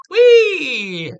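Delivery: high, loud, drawn-out whee; delighted squeal. Acted. Girl let into the crime scene, delighted squeal.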